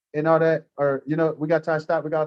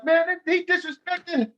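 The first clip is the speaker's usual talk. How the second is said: mock outrage; 13 semitones higher, slower than usual. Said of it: "go up to somebody else".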